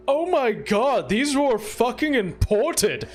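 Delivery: high, slow wail of dismay; acted dismay. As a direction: lament the ruined furniture, hammed-up. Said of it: "and he's like".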